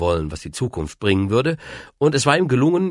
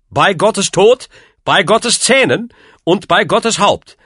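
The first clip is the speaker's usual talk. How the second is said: high, loud cursing; emphatic, raised-voice swearing; indignant oaths; 11 semitones higher, 6 dB louder, slower than usual. A narrator's reading. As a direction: fling out each oath with force. Enraged uncle's pious curses.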